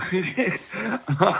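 high amused laugh. Real, not acted. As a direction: laugh at your own joke. Laugh after joke.

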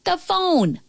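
high, slow holler; girl's drawn-out high call; shouting. A narrator's reading. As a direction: call instructions across a distance. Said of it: "shouted the girl".

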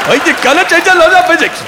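put-on high, loud, garbled Scottish accent; hammed-up, barely intelligible Scottish engineer; Scotty's Scottish accent. Engineer protesting to his captain, garbled Scottish accent. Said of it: "Scotty in Star Trek was the only one".

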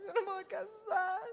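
put-on high tearful child's voice, stressing no; a little girl sobbing, leaning on the word no; imitating a crying child. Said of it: "Y se atacaba a llorar".

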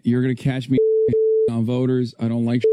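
ordinary talk

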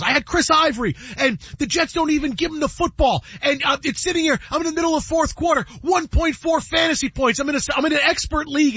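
high-pitched, worked-up complaint; Michael Rappaport. Retold or imitated. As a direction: rant like an aggrieved fantasy football player. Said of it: "And he goes".

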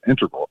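integral as IN-te-gral; stress on first syllable. Retold or imitated. Caller reporting his older daughter's pronunciation, integral as IN-te-gral. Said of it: "she said she pronounces it".

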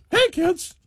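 very high-pitched cartoon mouse voice; Mickey Mouse. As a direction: squeak it out like a cheery cartoon mascot. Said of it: "Probably Mickey".

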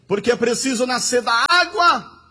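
loud, fervent pulpit climax; impassioned preacher proclaiming to the congregation; full-force preaching. Real, not acted. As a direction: drive each phrase home with rising intensity.

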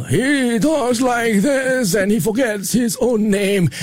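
put-on slow, high, befuddled old admiral; old admiral losing track of his own name; retired Navy admiral. Shown as a demonstration. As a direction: drag it out like a forgetful old sailor. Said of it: "a retired Navy admiral".

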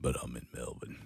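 very low, soft Aussie tradie voice. Retold or imitated. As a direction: drop right down into a blokey Aussie tradie. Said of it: "Like as if your voice isn't deep already".

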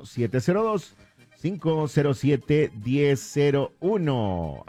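phone number spelled out slowly, stretching to the end; slow dictation. Real, not acted. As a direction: dictate it slowly so listeners can note it. Repeats number slowly for listeners.

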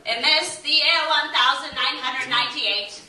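high, loud herald's declaration; mock royal proclamation. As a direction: proclaim it like a pompous royal decree.

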